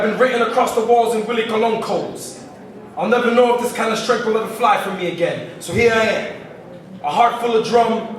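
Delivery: measured, declaimed poem with deliberate pauses; spoken word poetry. Acted. Poet offering a revelation about himself, measured spoken word delivery. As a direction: recite it line by line to an audience.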